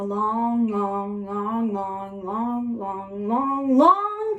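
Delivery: stretched, droning repeats of "long"; slow storybook opener milked for length; comically drawn out. A narrator's reading. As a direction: drag out each "long", swelling on the last. Storyteller exaggerating how long ago.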